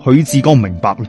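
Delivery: ordinary delivery, nothing marked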